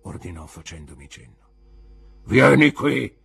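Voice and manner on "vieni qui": molto più alto e forte; grido rozzo. A narrator's reading. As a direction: urla l'ordine. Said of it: "disse l'uomo con la gamba di legno". (il custode ripete l'ordine gridando)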